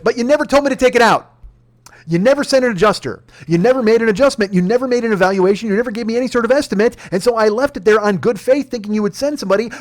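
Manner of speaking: high, loud furious outburst; angry customer yelling at the insurance agent; retold angry yelling. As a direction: let him have it, voice climbing and loud. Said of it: "I'm louder".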